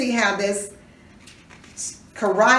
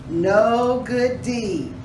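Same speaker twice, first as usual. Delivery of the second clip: slow knowing answer; slower than usual. Shown as a demonstration. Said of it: "up to what".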